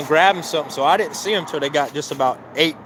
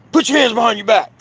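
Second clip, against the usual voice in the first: sharp, high, loud police command; a retold cop's loud order to put your hands behind your back; barked order; 9 semitones higher, 5 dB louder than usual. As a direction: snap the order out like a cop grabbing you. Officer suddenly cuffs him.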